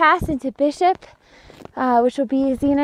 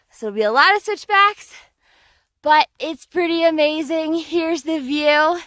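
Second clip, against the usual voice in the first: usual speaking voice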